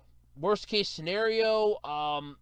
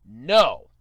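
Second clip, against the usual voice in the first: emphatic no; 7 semitones lower, 9 dB louder than usual. Real, not acted. Ranting about the runaround.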